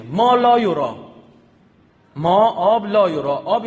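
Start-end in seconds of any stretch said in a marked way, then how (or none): 0.0-1.0 مالایورا in drawn-out Arabicised syllables; loud, demonstrative مالایورا; Arabicised form. Shown as a demonstration. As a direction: say مالایورا loudly, as an Arabicised word. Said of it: "خب اینو باید عربیش کن".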